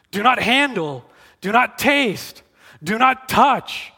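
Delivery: slow, measured, each "not" hit; deliberate mock-commandment; emphatic rule quote. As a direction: spell out the prohibitions firmly. Quoting rules emphatically.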